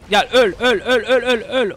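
laughing through the words; laughing taunt. Real, not acted. Gamer laughing at a dying enemy, laughing taunt.

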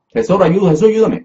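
high, loud mock plea; acted cry for help. Preacher voices sinking Peter calling Jesus, high urgent plea. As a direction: call out urgently as if going under.

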